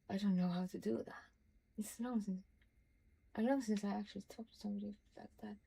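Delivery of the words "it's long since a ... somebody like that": ordinary talk, nothing marked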